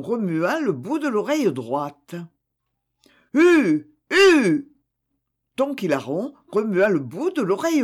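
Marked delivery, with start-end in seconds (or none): 3.3-4.7 loud, high, double shout; farmer's wife losing patience with the donkey; exasperated shouts. Acted. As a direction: yell twice to get him going. Wife yells again and again.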